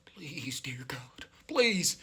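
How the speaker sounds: soft whispered begging prayer. Retold or imitated. Lying on the bathroom floor, a whispered prayer. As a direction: pray under your breath. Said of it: "And I'm like".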